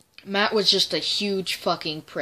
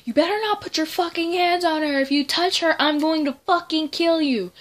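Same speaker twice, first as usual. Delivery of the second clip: much higher, furious threat; threatening Leah; 10 semitones higher, 3 dB louder than usual. Retold or imitated. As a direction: snarl it like a protective friend. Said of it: "She was like".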